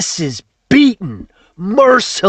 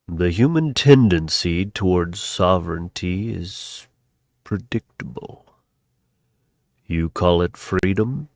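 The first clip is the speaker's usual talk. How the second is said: much lower, softer cold voice; sinister machine voice; 8 dB quieter than usual. Acted. A cold machine voice tells viewers freedom is a malfunction. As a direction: speak like a cold machine overlord.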